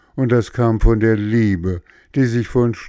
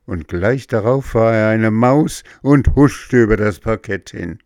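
usual speaking voice

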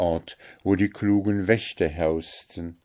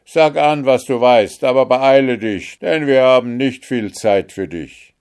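loud, higher impatient call; 6 semitones higher, 9 dB louder than usual. A narrator's reading. Guards rushing Märchen, loud impatient call. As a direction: hurry her up. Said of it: "riefen sie".

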